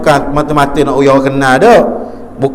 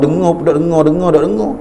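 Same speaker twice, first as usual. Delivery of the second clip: nasal mumble; 2 semitones higher than usual. Retold or imitated. Teacher imitating a nasal speaker's mumble. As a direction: mumble it through the nose, half swallowed. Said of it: "cakap lagu ni jenis pasal sengai".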